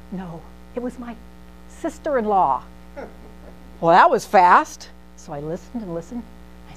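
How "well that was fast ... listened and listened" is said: ordinary talk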